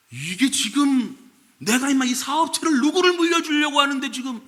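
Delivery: father blowing up at his son's plan, high and loud; indignant father. Retold or imitated. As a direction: scold the son who wants seminary. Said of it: "뭐, 이런 식인 거예요".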